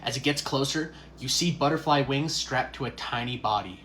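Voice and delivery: ordinary talk, nothing marked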